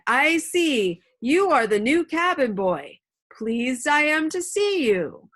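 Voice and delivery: hearty loud pirate welcome, slowed down; quite loud. A narrator's reading. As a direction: boom it out like a jolly landlord. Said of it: "quite loud".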